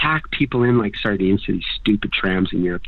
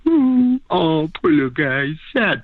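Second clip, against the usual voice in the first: mock pitying; 3 semitones higher than usual. Voicing pity for a dog.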